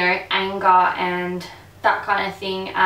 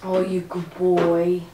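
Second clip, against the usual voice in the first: talking to a dog; slower than usual. Real, not acted. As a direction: coo at the dog slowly. Praising the dog.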